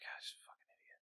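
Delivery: barely audible whispered insult; muttered, whispered put-down; under her breath. Acted. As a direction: whisper it as a private aside. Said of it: "like, under her breath".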